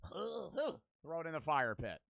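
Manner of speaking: ordinary talk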